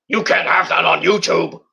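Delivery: put-on bossy executive voice; Vince impression. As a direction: forbid it as a caricatured company boss. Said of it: "Vince doesn't want anything".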